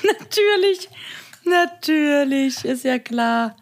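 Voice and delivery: high, slow mock agreement; sarcastic. Real, not acted. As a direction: stretch it out sarcastically. Ironic drawn-out agreement.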